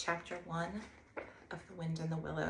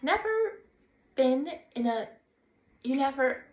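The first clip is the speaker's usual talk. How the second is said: much higher, much louder, building disbelief; spluttering astonishment; 9 semitones higher, 12 dB louder than usual. Acted. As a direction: sputter in shock, climbing up. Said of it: "open-mouthed".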